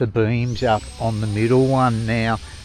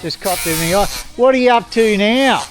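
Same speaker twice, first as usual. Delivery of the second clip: louder, higher, calling across the yard; 6 semitones higher, 4 dB louder than usual. Real, not acted. Husband calls across the yard to his wife, loud. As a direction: call out to her over the trimmer noise.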